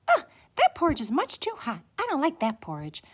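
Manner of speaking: put-on high, quick little-girl complaint; displeased Goldilocks. A narrator's reading. Goldilocks after trying too-hot porridge, high displeased voice. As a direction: reject it in a fast, high child voice.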